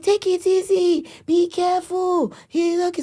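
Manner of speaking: imitating her mother. Daughter mimics grandmother fussing over rambunctious boys, high and slow. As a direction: coo it out slowly like an anxious granny. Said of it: "she's like".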